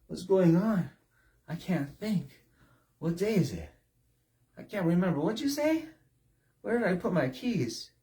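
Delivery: whispered start, then rising confused questions; dazed and breathless. Pretend. Preacher acting out a confused, exhausted drunk. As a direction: play someone losing their grip.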